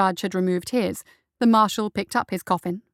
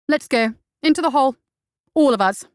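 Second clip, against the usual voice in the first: loud, higher ordering; furious command; 5 semitones higher, 7 dB louder than usual. Acted. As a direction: order everyone down in a rage. Justice orders pursuit in fury.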